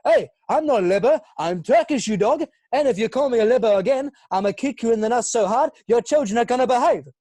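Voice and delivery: loud, high mock-angry Turkish-Australian lad; hammed-up street-tough threat in a put-on accent; Yilmaz. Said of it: "perfect Yilmaz line going".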